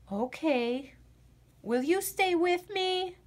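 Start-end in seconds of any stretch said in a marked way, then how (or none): none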